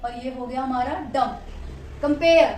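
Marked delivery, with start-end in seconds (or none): 2.0-2.5 "compare" with a louder, weightier "pair"; stress on second syllable. Shown as a demonstration. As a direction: hit the "pair" of "compare" harder than "कं". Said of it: "pair पे ज्यादा focus है".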